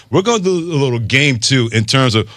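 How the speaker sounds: laughing through the words; cracking up mid-sentence. Real, not acted. Host cracks up mid-promo.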